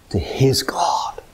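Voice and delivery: slow, reverent whisper; hushed climax. Real, not acted. Preacher on Jesus clinging to God, whispering. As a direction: let it drop to a whisper.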